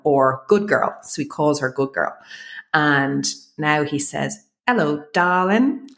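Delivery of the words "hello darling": put-on toddler's drawn-out, higher English accent; clear English accent. Toddler greets the family dog Storm, in a clear English accent. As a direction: imitate a little one's very clear English accent. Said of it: "in this extremely clear English accent".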